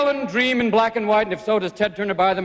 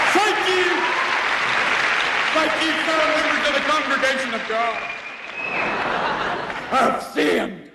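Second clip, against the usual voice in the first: televangelist; 5 semitones higher than usual. Acted. Swaggart televangelist impression.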